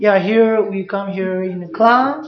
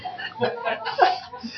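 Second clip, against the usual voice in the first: high laugh from the teacher; burst of laughter; 8 dB quieter than usual. Real, not acted. Teacher laughing at a student's question, high burst of laughter.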